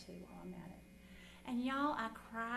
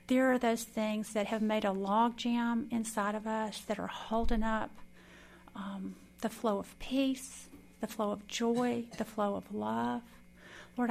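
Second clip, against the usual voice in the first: loud, earnest praying voice; loud heartfelt closing prayer; fervent prayer; 9 dB louder than usual. Acted. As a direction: pray it aloud with conviction.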